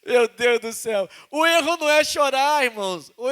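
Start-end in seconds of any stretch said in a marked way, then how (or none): 0.0-1.1 slow, half-laughing, half-crying sigh; a real teary chuckle; laughing through tears. Real, not acted. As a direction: laugh it off while still teary.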